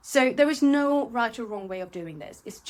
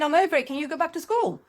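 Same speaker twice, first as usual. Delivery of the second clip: exasperated; 8 semitones higher, 4 dB louder, faster than usual. Retold or imitated. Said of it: "when I went".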